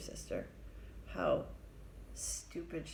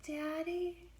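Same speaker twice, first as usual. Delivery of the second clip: put-on high, small, hopeful child's voice; little girl voice; 10 semitones higher than usual. Girl greeting her father calling from jail, high and small. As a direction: go much higher, like a tiny girl. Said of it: "her voice is like".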